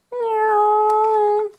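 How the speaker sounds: high little cat meow; kitty meow. Pretend. Toy kitty calling out, a high meow.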